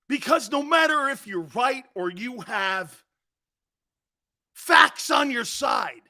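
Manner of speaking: high, slow shouted outrage; angry shout. Real, not acted. Host rails against the commissioner, shouting high and slow. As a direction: hammer each point home.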